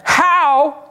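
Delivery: a touch of a skeptical woman at the well. Retold or imitated. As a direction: challenge him, doubting. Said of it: "She said".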